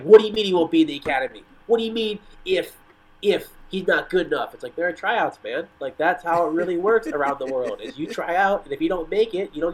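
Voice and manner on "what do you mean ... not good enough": mock outrage, pitched up and insistent; freaked-out parents. Parents protesting the academy's tryout rule, mock freak-out. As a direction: imitate indignant parents in disbelief. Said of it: "all the parents freaked out".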